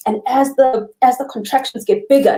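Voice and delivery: ordinary delivery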